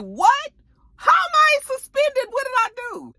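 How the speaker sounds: high, loud disbelieving cry; shocked outrage. Retold or imitated. Suspended investigator crying out at the chief in shock. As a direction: squeal it in disbelief. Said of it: "She was like".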